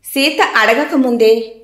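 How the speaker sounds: ordinary delivery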